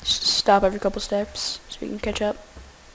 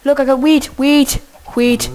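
louder, higher calling out; loud luring call; 4 semitones higher, 8 dB louder than usual. Real, not acted. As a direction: call the cow back with wheat. Luring cow back loudly.

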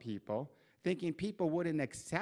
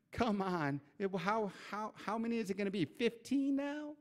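higher, with a sneer stretched on Fifteen now; mock incredulous taunt; 7 semitones higher than usual. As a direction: scoff in disbelief at her past. Devil's mocking taunt continued.

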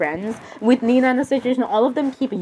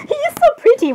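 put-on swooning girly voice; 4 dB louder than usual. Swooning over Jeff.

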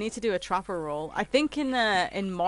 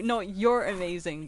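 ordinary delivery

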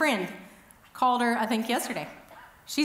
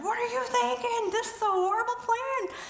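much higher, squealing panic on the ski slope; panicked fit; 11 semitones higher than usual. Retold or imitated. Woman terrified atop a ski hill, to her friend: high panicked squeal. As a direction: shriek it like you're losing your mind up the mountain. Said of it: "I am pitching a fit".

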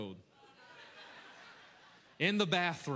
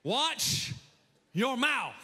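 high, loud, punched-out warning; ferocious; 9 semitones higher, 6 dB louder, much slower than usual. Shown as a demonstration. Preacher showing the congregation the title, ferocious shout. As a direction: bark it with a pause after the first word. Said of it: "give some ferociousness behind it".

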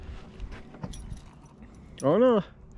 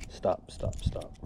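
breathy hushed stop to the fish; soft unvoiced plea while handling the fish; quiet whisper; 5 semitones lower, 11 dB quieter, slower than usual. Real, not acted. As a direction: whisper it under your breath. Unhooking a wriggling fish.

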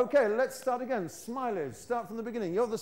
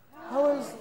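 more ganas; 6 semitones higher, 4 dB louder than usual. Shown as a demonstration. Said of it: "a little, little bit more Ganas".

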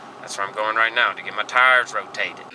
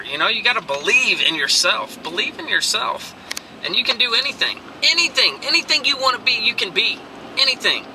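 climbing higher and higher with excitement; fired-up motivational preaching; rising pep talk; 10 semitones higher than usual. Real, not acted. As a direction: pump them up, building to a peak.

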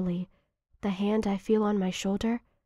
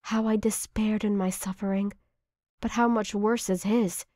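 usual speaking voice

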